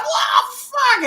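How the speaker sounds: high-pitched startled scream; acting out a jump scare; retold scream. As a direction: shriek the curse in mock terror.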